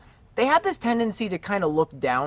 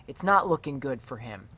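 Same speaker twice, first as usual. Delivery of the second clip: normal talking voice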